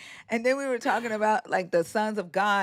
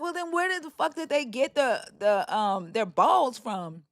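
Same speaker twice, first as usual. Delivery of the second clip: incredulous; 5 semitones higher than usual. Retold or imitated. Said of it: "I was like".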